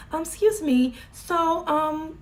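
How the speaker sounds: playing at sounding proper at work; mock proper voice. Imitating herself talking proper to coworkers, high and prim. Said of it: "I used to try to talk proper".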